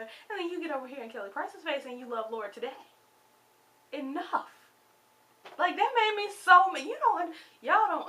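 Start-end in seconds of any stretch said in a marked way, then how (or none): 3.9-4.5 firm enough. Real, not acted. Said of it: "how upset I am about this". 5.6-8.1 higher and louder, words tumbling out in anger; upset outburst. Real, not acted. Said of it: "how upset I am about this".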